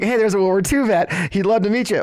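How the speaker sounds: hammed-up helper pointing out the veteran; mock eager staffer. Someone else flagging the veteran.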